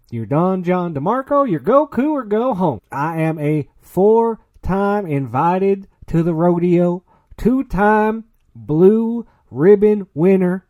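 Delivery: put-on podcast host hamming up a cowboy accent to open the show; hammed-up cowboy accent. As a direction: welcome the cowpokes like a Texas rodeo announcer. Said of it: "We gotta drop the accent".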